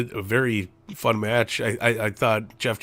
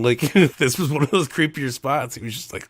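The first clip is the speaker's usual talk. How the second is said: normal talking voice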